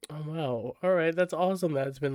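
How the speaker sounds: ordinary delivery, nothing marked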